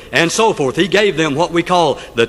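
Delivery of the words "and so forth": normal talking voice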